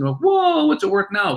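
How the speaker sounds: big high whoa of amazement; be floored by the price; amazed whoa. Retold or imitated. Bar patron amazed by the coin's value, astonished.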